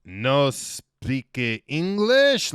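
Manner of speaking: put-on over-the-top French accent, hugely drawn out; bad French accent. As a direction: ham up the French, loud and unsure, as a question. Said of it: "really shitty, bad French accent, over the top, questioning himself".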